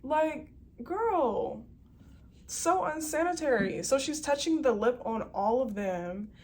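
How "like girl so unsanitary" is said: disgusted sass. Real, not acted. Disgusted reaction to attendant.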